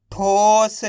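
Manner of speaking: ठोस with a long held "o" and light final स; half final letter. Shown as a demonstration. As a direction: draw out ठोस for learners, halving the स. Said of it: "last वाले अक्षर का उच्चारण आधा किया जाता है". (ठोस को खींचकर, आखिरी स आधा)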